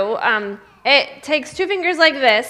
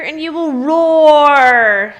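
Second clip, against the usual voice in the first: loud, slow, drawn-out roar; a big slow playful roar for the kids; mock roar; 7 dB louder, much slower than usual. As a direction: stretch out roar like a growl. Teasing the roaring dinosaur.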